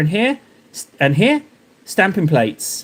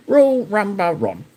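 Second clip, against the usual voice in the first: robotic greeting voice; 3 semitones higher than usual. Pretend. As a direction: chant it like an automated greeter. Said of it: "Sounds a little bit like, um, junk, the Universal Greetings".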